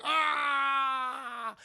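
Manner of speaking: long, high, loud "ah"; imitated scream of pain. Villain stabbed in the eye, imitated scream. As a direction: scream it out, drawn out.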